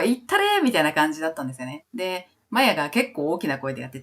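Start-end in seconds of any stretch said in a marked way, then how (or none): 0.0-0.6 high-pitched imitated cheer; egging-on call. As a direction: imitate a bandmate shouting encouragement, pitch leaping up. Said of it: "その掛け声のかけ方が".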